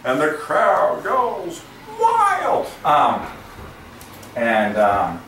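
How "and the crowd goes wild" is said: slow, stretched hype call; drawn-out announcer call. Pretend. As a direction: drag the words out for the crowd. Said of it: "you just drag it out".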